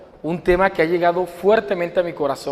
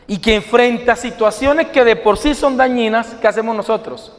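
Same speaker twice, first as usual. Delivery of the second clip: ordinary talk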